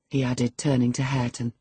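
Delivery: usual speaking voice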